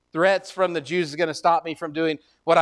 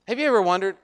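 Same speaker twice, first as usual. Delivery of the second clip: ordinary delivery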